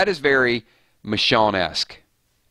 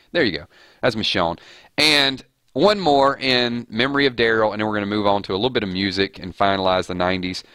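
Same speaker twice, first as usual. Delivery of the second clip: ordinary delivery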